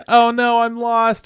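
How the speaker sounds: put-on high, loud whiny little-girl cry; mock lost little girl. Retelling the film, voicing the scared lost girl. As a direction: squeal it like a lost kid. Girl's voice in retold scene.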